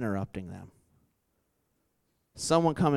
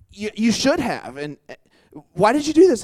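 high, indignant mock scold, rising louder to the end; imitating mad Nikki; 8 semitones higher, 6 dB louder than usual. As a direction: play the offended coworker, pitch climbing. Mad Nikki confronting speaker.

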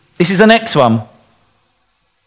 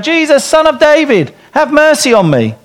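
higher pitched calling out; mock call out; 9 semitones higher than usual. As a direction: call out to Jesus from the crowd, pitched up. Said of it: "he called out".

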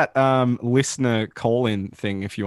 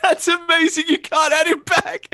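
cracking up at the disaster; laughing hysterically. Real, not acted. Cohost cracking up that the guest can't rejoin.

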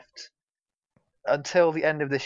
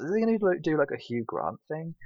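retold inner voice; 5 semitones higher than usual. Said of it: "My first thought was".

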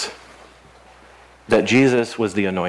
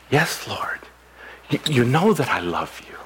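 whispered, softer reply; hushed whisper; 4 dB quieter than usual. Acted. Peter whispering his answer to Jesus. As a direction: breathe the answer out, barely voiced.

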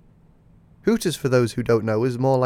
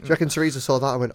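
ordinary talk

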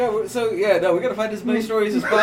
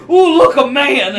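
lightly voiced flirty creature, a touch louder; mock coy Sasquatch; 4 semitones higher, 6 dB louder than usual. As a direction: coo it like a smitten beast.

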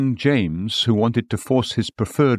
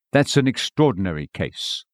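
usual speaking voice

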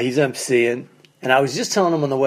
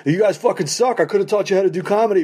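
higher, fast sneering jab; mock parting insult; 6 semitones higher, faster than usual. Imagined car dealer insulting the comics as he leaves, higher and fast sneering. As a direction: toss it off like a bitter grenade.

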